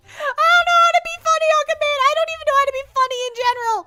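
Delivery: squeaky high, panicked whine; high-pitched penguin panic; mock panic. Pressured to be funny on command.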